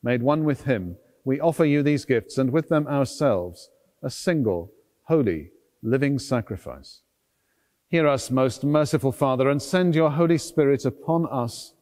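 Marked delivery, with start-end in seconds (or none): none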